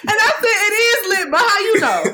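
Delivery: high, loud, laughing retelling; laughing through it. Real, not acted. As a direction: crack up while quoting yourself.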